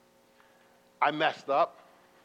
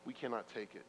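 much lower and far softer, giving up; defeated spy; 8 semitones lower, 18 dB quieter, faster than usual. Retold or imitated. Spy telling Moses it can't be done, defeated. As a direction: drop it to a beaten murmur. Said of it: "And the spy said".

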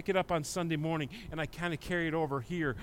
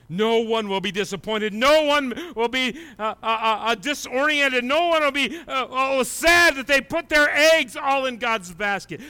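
louder, higher preaching with stumbles; building preaching; 7 semitones higher, 8 dB louder than usual. Acted. Preacher assuring the church nobody is disappointed, building. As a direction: build it up to the congregation.

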